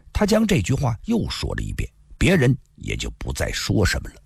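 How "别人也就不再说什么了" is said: usual speaking voice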